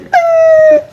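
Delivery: high sung ting like a bell; vocal bell sound effect; mock magic chime. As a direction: chime it again for the wish. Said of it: "may ting pa".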